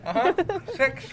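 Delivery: counting with stuffed cheeks; mouth full. Real, not acted. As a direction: speak round a mouthful. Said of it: "He's just chipmunking it".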